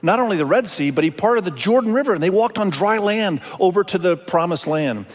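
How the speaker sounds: normal talking voice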